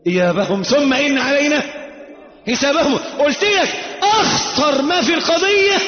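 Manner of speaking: high, loud warning from the pulpit; impassioned preacher's shout; full-force preaching. Real, not acted. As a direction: drive the warning home forcefully.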